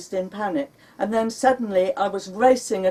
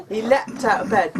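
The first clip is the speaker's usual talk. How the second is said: ordinary delivery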